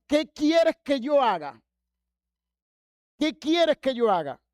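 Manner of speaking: high, loud, slow appeal; fervent question. Retold or imitated. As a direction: cry it out, then repeat it emphatically.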